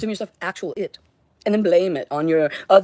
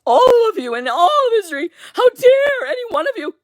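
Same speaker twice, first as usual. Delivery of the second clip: much higher, louder cry of fury that breaks into tears; tearful rage; 4 dB louder than usual. Real, not acted. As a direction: hurl it at everyone, voice cracking. Raging at all of history.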